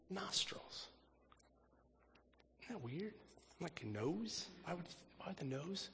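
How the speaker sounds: nostrils in a soft, breathy whisper, then quiet puzzled questions; hushed, puzzled wonder at the nose. Acted. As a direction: lean in and puzzle over it softly. Marvels at the odd detail.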